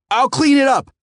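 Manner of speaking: very high, loud eager cry; cried out. A narrator's reading. Teen cries out to the angry customer. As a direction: cry it out desperately. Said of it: "I cried out".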